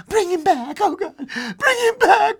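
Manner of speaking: very high, loud tearful begging; crying plea. A narrator's reading. Old woman begging husband to bring son back, crying. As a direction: wail and beg desperately. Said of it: "cried the old woman".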